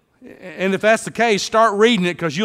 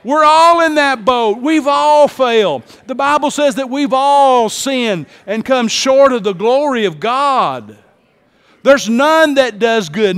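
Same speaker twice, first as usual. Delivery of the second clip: higher, louder fiery sermon; full-force preaching; 7 semitones higher, 7 dB louder, slower than usual. Acted. Pastor preaching to congregation on sin, full force. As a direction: preach it hard to the rafters.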